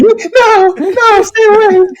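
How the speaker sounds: high, crying plea; mock terrified wail. Imagined woman answering his greeting, mock terrified wail. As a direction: play someone panicking and warding him off. Imagined frightened reply to him.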